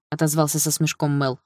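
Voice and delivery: ordinary delivery, nothing marked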